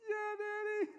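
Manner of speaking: very high mock wail; hammed-up squeaky crying voice; freezing, tearful child. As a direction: imitate a miserable little kid agreeing. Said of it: "she is freezing".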